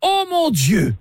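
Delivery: hammed-up alarm at a man coming with a shovel; hammed-up outrage. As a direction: play scandalised gentleman. Caller feigning outrage and alarm.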